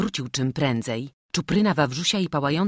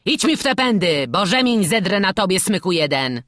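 loud, raised-pitch scolding; cross, shouting master; angry threat; 5 semitones higher, 8 dB louder than usual. A narrator's reading. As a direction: threaten the lazy boy.

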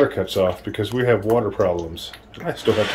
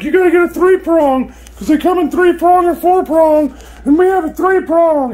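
much higher, put-on old lady voice; 4 dB louder than usual. Telling the camera what the old lady advised, high old lady voice. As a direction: mimic her chatty advice in a high voice. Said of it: "She's like".

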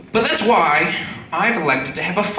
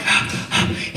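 mock gasp. Partner gasps in bed.

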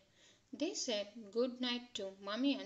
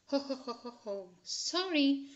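snorting laugh; 3 semitones higher, 4 dB louder than usual. A narrator's reading. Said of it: "snorted Daddy Pig".

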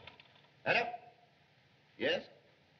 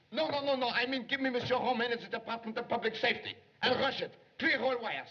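rushed, pitched-up and urgent; frantic phone call; 7 semitones higher than usual. Acted. Flustered secretary rushing the operator, frantic. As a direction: gabble it out in a panic to the operator.

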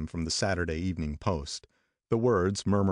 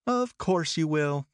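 higher, slow teasing Daisy; Daisy teasing Nick, higher and slow; Daisy confirming; 10 semitones higher, slower than usual. A narrator's reading. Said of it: "confirmed Daisy".

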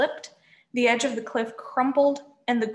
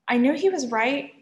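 plain reading voice

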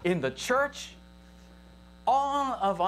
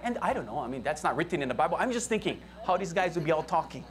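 usual speaking voice

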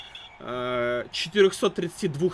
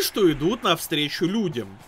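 ordinary talk, nothing marked

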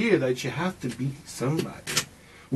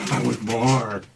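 mock greedy voice; 5 semitones lower, much slower than usual. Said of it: "when you have the money".

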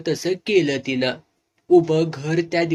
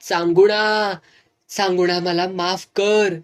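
calling out; 3 semitones higher, 4 dB louder than usual. A narrator's reading. Grieving man calls into the dark well for forgiveness, crying out. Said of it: "मोठ्यानं ओरडला".

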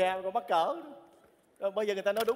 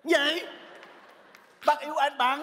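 loud, high disbelief; 7 semitones higher, 4 dB louder, slower than usual. Acted. Man learns whom she secretly loves, loud exclamation. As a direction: blurt it out at high pitch. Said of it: "Sao cậu nói lớn thế?".

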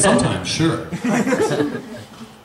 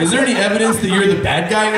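normal talking voice

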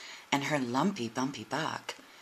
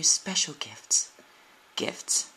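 whisper; much slower than usual. A narrator's reading. Tender storytelling, gentle wonder.

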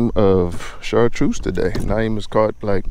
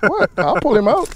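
much higher, startled pitch; high surprised; 14 semitones higher than usual. Real, not acted. Buddy startled while grabbing the fish, high and surprised. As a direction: squeak it out in surprise. Reacting during the fish struggle.

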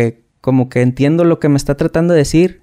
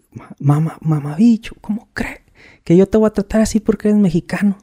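imitated indignation; 7 semitones higher than usual. Sergeant denies bias to the Mexican recruit, mock indignant. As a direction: play the offended sergeant, incredulous. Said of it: "el vato se encabrona".

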